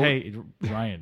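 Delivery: muttering dim guest; Delaware Dave. Acted. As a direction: drift in like a sleepy guest. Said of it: "Delaware Dave voice".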